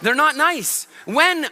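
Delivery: ordinary talk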